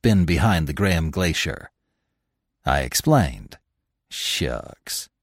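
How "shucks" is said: breathed, softer scoff; dismissive shucks. A narrator's reading. Trapper scoffing at the glacier story, whispered. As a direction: brush it off under his breath. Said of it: "he said".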